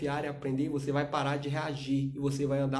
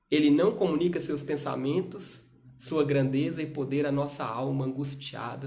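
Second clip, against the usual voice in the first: ordinary delivery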